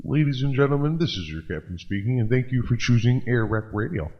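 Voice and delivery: mock pilot on the intercom, low and hushed; deadpan captain announcing the crash; airport announcement.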